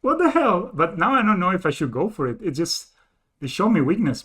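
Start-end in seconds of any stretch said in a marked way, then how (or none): 0.0-0.7 stunned disbelief. Real, not acted. Still stunned by opponent.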